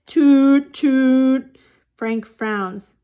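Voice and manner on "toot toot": trumpet toots. A narrator's reading. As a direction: toot it out on a held note. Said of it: "Bean plays his trumpet".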